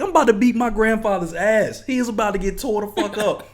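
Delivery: high, loud indignant ranting; worked-up comic fury; angry threat. Retold or imitated. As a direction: vow a beating at full indignation. Acting out his angry threat.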